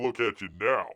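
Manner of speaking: usual speaking voice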